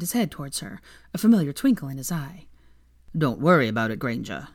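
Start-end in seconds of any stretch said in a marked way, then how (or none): 3.2-4.5 lower, lightly voiced old barman; easy, knowing reassurance. A narrator's reading. Aberforth calmly reassuring Hermione, a lightly lowered voice.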